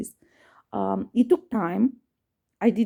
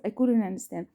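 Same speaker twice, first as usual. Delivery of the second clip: ordinary talk, nothing marked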